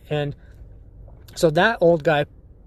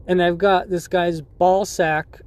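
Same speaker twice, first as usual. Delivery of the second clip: ordinary delivery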